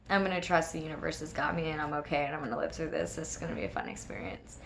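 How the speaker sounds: soft, low, quick self-talk; quiet self-reassurance. Retold or imitated. Nervous jumper telling herself she'll live through this, quiet self-reassurance. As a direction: talk yourself into it under your voice.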